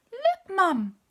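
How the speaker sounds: put-on high, loud child calling out to mum; a small boy calling his mother excitedly; little boy calling. A narrator's reading. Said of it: "called a little boy".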